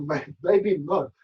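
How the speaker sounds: slowly, through a laugh. Real, not acted. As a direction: laugh it off, teasing. Teasing refusal, laughing.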